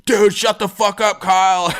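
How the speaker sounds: high, loud, sing-song shout-down; retold shout from the drunk partygoers; crowd yelling. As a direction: bark it at the friend who won't quit.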